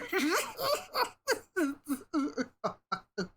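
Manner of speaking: long high laugh trailing down. Real, not acted. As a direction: laugh it out, falling away. Laughs at sewer joke.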